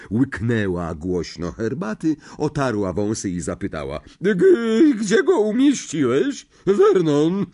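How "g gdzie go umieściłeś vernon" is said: put-on high, loud, overbearing old aunt; a big domineering aunt who loves her dog; bossy aunt. A narrator's reading. Marge asks Vernon. (władcza ciotka, wysoki głos)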